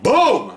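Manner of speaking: loud, high boom of an exploding cigar; really loud boom. Shown as a demonstration. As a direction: imitate a huge blast on boom. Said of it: "This one's like".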